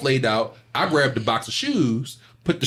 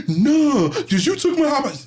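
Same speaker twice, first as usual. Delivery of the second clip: put-on crying kid; 12 semitones higher than usual. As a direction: wail it like a kid in tears. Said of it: "he was crying".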